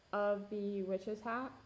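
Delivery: ordinary delivery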